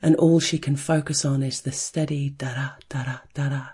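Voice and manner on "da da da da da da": soft unvoiced whisper of the heartbeat; whispered heartbeat rhythm; hushed. A narrator's reading. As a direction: breathe the rhythm like a pulse. Heartbeat rhythm echoed softly.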